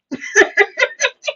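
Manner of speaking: high delighted cackle; a soft high amused laugh. Real, not acted. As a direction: burst into a giggle. Laughing at the burn.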